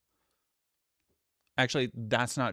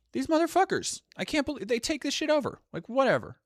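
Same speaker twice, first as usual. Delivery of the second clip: indignant outburst in a pitched-up voice; exasperated rant; 13 semitones higher than usual. Real, not acted. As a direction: rant it out, much higher, fast. Ranting about TikTok takeover.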